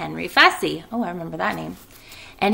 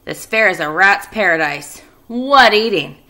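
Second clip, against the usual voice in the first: loud, gleeful rat voice; gloating rat; 7 dB louder than usual. Acted. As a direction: relish every treat. Rat gloats about fair.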